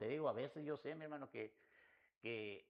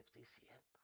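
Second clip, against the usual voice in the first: barely spoken surrender; 3 semitones lower, 23 dB quieter than usual. Retold or imitated. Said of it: "hasta que dije".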